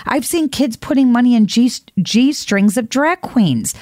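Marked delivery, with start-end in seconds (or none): none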